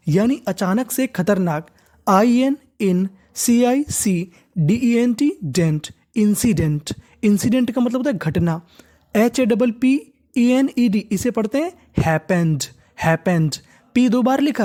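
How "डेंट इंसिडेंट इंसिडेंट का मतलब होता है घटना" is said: usual speaking voice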